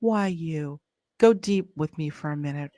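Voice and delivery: ordinary delivery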